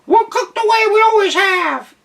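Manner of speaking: very high, loud meowing cat snap; snapping cat. A narrator's reading. Cat snapping at the duck, high and loud. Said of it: "snapped the cat".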